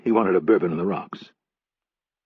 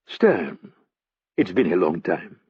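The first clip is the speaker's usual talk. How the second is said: put-on French accent; 2 semitones lower, slower than usual. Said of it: "The man spoke in English with a French accent".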